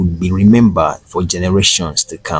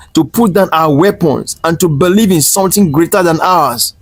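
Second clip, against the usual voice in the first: calm conviction; 7 semitones higher than usual. A narrator's reading. Nephew pleading with his uncle, growing impassioned. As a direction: pour growing conviction into every line. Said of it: "his voice calm but filled with conviction".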